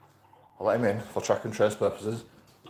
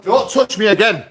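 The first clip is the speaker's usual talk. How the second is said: furious shout, much higher and louder; 10 semitones higher, 8 dB louder than usual. Real, not acted. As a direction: scream the warning at him. Landlord grabbed phone.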